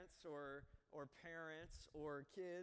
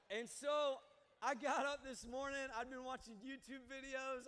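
excited; 8 semitones higher, 9 dB louder, slower than usual. Real, not acted. Comic bow tie reveal.

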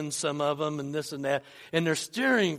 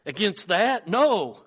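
acted protest, higher and louder; retold yell; 3 semitones higher, 5 dB louder than usual. Countryman refuses to fight the army, acted yell. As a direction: call it out like a doubter in the ranks. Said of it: "someone yells out".

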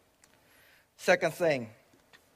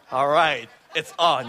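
laughing; 6 dB louder than usual. Retold or imitated. Dogs ate his food.

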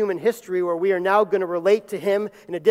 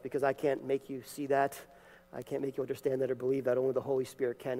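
ordinary talk, nothing marked

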